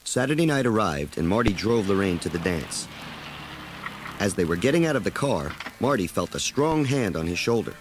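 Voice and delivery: usual speaking voice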